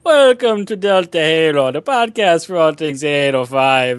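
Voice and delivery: hammed-up high Irish brogue, slowing at the end; Irish accent. Host redoing the podcast intro on request, Irish accent. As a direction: lay on a cartoon Irish accent.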